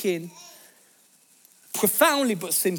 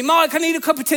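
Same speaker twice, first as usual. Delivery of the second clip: loud, high shout across the house; retold shout; 7 semitones higher, 5 dB louder, faster than usual. Wife shouting to husband for tea, loud and high. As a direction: yell for tea at your husband. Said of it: "she shouts, she's shouting at me".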